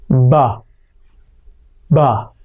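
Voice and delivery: B popped from lips pulled back over the teeth; exaggerated ba. Shown as a demonstration. Teacher demonstrating an exaggerated toothless ba. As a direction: exaggerate the ba like you have no teeth. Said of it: "start by exaggerating it".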